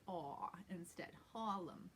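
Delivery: "aw" in place of the R, "Harlem" as HAW-lem. Shown as a demonstration. Teacher explains the Harlem vowel, aw for R. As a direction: stretch "Harlem" slowly with an aw and no R. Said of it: "it's almost like you say".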